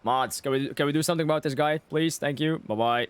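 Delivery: usual speaking voice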